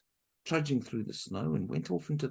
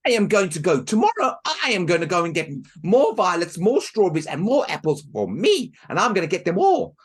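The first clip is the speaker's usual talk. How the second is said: loud, higher greedy boast; greedy girl; 4 semitones higher, 13 dB louder than usual. Acted. Helen boasting to Marushka, loud and greedy. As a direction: boast it selfishly.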